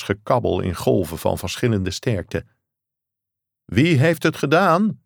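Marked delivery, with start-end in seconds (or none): 3.6-5.0 high, urgent mock shout into the phone; a narrator's light shout down the receiver. A narrator's reading. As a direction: call the question over a bad line. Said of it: "schreeuwde Thorson".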